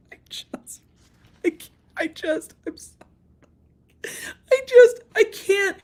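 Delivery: wheezing, squeaky laughter between the words; helpless laughter. Real, not acted. Unable to finish over the lawsuit, wheezing laughter. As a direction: lose it in breathless giggles. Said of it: "unvoiced far".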